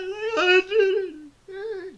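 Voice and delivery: high, slow hammed-up sobbing; mock weeping. Golfer celebrating a hole in one, mock weeping. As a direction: bawl it out in a put-on high voice. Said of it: "Like, weep".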